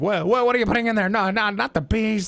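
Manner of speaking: high, frantic movie-freakout imitation; Nick Cage. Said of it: "the example that everyone always goes to".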